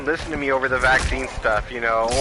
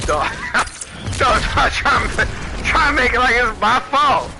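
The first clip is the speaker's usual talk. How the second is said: high, loud taunting yell with a cackle; laughing shout; 9 semitones higher, 3 dB louder than usual. Real, not acted. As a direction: shout it back at the haters, laughing. Shouting back at critics.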